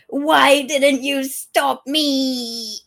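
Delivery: mock anguished wail, loud and drawn out; grief-stricken. The king's monologue, mock grief-stricken. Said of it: "the king's grief-stricken monologue going".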